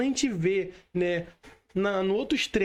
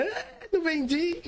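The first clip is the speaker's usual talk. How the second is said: mock despair; 9 semitones higher, slower than usual. Mock despair over no sale.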